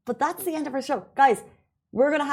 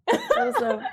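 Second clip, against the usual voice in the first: laughing. Real, not acted. Laughing at mom's comment.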